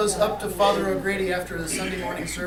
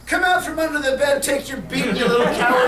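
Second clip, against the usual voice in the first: higher, louder mock demand; wife's taunting order; 6 semitones higher, 9 dB louder than usual. Wife to husband hiding under the bed, taunting order. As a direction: call it out like a scolding wife.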